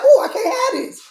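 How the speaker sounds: high-pitched, laughing mock alarm; reliving the shock, voice leaping way up; alarmed exclamation. Host retelling the fried fish temptation to listeners, mock alarm.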